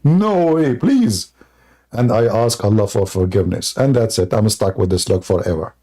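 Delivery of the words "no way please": high, panicky mock disbelief; shocked waking up. Himself waking up changed, high shocked pleading. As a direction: squeal it like you just saw your new face. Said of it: "I'm like".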